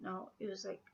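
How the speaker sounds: normal talking voice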